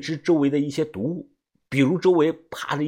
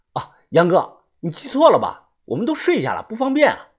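high, raised voice through a door; calling out excuses; 6 semitones higher, 4 dB louder than usual. A narrator's reading. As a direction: put him off loudly and politely. Calls excuses through the closed door. (隔着门高声推辞)

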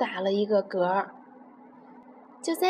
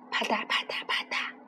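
whispered hopping sounds; 8 dB quieter than usual. Acted. Baby elephant mimics hopping.

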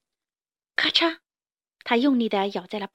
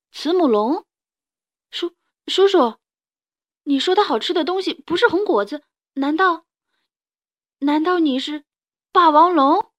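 put-on high, loud, alarmed child voice; dawning fear, rising to a cry; frightened child; 6 semitones higher, 5 dB louder than usual. Child realises he is a T-rex.

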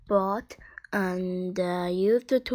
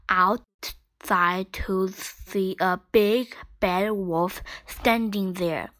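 plain reading voice

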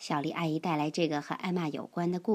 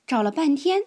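plain reading voice

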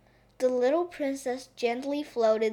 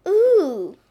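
high, loud gasp of delight; eager little princess exclamation; excited idea; 5 semitones higher, 9 dB louder, much slower than usual. A narrator's reading. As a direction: burst out with a new idea. Princess claps with a new idea.

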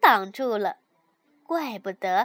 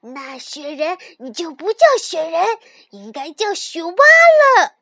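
raised voice; 3 semitones higher, 12 dB louder than usual. A narrator's reading. The rabbit argues with his friend, loud huffy retort. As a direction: raise your voice to win the argument. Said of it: "立刻提高了嗓门儿".

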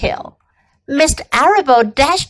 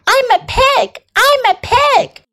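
put-on high-pitched, gleeful child's shout; Avery yelling; 12 semitones higher than usual. A narrator's reading. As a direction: voice an excited boy, pitched way up. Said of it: "yelled Avery".

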